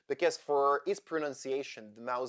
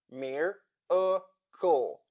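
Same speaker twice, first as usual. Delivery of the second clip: very slow 'Mir-a-kle', a pause between syllables; 3 semitones higher, 3 dB louder, much slower than usual. Shown as a demonstration. Teacher breaking down a word for learners, syllable by syllable. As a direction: break 'Mir-a-kle' into three separate, drawn-out pieces. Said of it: "break down this word".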